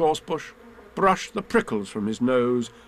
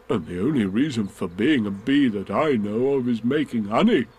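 lightly voiced pondering bear. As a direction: puzzle it out as a thoughtful bear.

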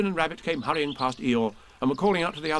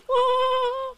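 high delighted giggle; squeaky baby giggle; 5 dB louder than usual. Acted. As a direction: giggle like a tiny joey. Roo laughing.

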